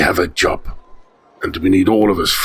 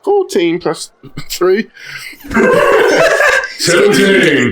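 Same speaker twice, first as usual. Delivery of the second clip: laughing; 12 semitones higher, 4 dB louder, slower than usual. Real, not acted. Laughing through his roll result.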